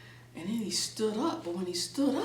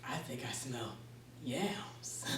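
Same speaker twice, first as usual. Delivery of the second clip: hushed, much softer, savouring a smell; quiet sniffing; 11 semitones lower, 12 dB quieter, slower than usual. Acted. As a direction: murmur it to yourself as you catch the scent. Anansi sniffs food on the road.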